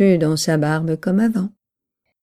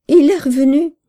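higher-pitched, urgent narrated exclamation; alarmed announcement; 6 semitones higher, 4 dB louder than usual. A narrator's reading. Neighbour announcing a feared return, alarmed. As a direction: break dreadful news in alarm. Neighbour breaks dreadful news.

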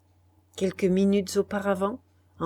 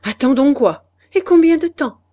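sharp, loud, bitter retort; harsh; 8 semitones higher, 13 dB louder than usual. Acted. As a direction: snap it back harshly. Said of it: "dit Madeleine avec âpreté". (ton âpre, plus haut et plus fort)

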